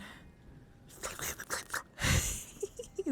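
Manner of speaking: stifle a laugh and fail; whispered giggle fit. Real, not acted. Cracking up at her own caked-up joke, stifled giggling.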